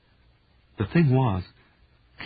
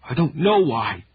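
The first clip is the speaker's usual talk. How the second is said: raised, sharp shout; 6 semitones higher, 6 dB louder than usual. A narrator's reading. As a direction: snap it out as a loud shout. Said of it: "he shouted".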